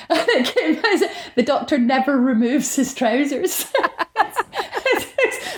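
laughing while telling the rule. Real, not acted. Laughing as he tells the host about the trouser rule. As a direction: chuckle through the line.

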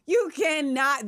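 high, drawn-out protest; slow, emphatic forbidding; scandalized objection. Real, not acted. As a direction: stretch it out in amused alarm. Objecting to co-host's incitement.

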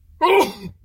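sharp harsh cough; loud hacking cough; sudden loud cough. Real, not acted. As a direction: cough hard from an itch. Nose irritation, eyes watering.

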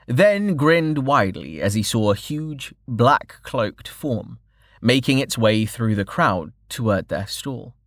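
plain reading voice

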